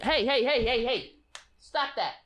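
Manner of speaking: sharp, high calls to make the cat stop; high-pitched warning at the cat; scolding the cat. Real, not acted. Scolding the cat off something.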